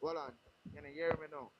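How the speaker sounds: distant and faint, away from the phone; speaking from across the room, faint; far from mic. Real, not acted. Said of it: "It sounds like you're far away from your device".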